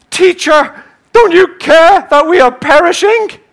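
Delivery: high, loud, desperate plea over the storm; panicked cry. Acted. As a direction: scream it like you're about to drown.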